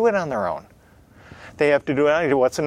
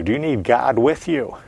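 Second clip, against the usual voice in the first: usual speaking voice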